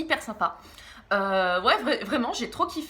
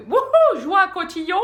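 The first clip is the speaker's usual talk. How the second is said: much higher, loud mock whoop; mock party cheer; 9 semitones higher, 10 dB louder than usual. Loser cartoonist who hates fun, mock party whoop. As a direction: whoop it like a fake party animal. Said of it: "en mode".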